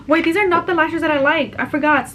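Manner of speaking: sudden high, loud realization; surprised. Real, not acted. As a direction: blurt it out as it dawns on you. Notices wrong lashes mid-story.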